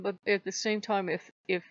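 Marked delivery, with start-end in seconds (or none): none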